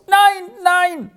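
very high-pitched wailing refusal; moaning. A narrator's reading. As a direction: moan it in high-voiced distress. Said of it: "stöhnte Peppino".

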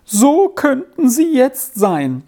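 high, loud wailing lament; keen for the lost children, voice climbing. A narrator's reading. Grieving mother wails about her children to the visitors. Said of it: "jammerte die Frau fort".

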